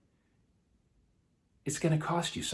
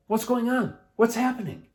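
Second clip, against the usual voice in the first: high, louder calling question; 10 semitones higher, 4 dB louder than usual. A narrator's reading. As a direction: call out to a passing crowd. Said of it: "he asked out".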